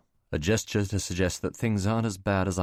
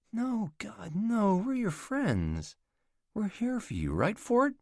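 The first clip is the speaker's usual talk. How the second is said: much higher, slow reassuring woman; reassuring hostess; 14 semitones higher, slower than usual. Acted. Catherine reassures Alec they are his friends, much higher. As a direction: play the caring mother figure.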